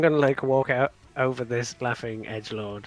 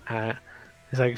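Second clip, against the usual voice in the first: usual speaking voice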